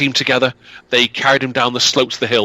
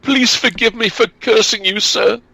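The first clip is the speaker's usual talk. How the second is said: put-on high pleading girl, a touch of tears; high tearful plea; tearful begging; 11 semitones higher than usual. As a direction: beg forgiveness high and pitiful. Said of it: "burst into tears and begged forgiveness".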